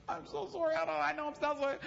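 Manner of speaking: lightly voiced nervous secretary interrupting the meeting; flustered secretary.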